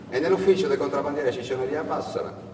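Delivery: plain reading voice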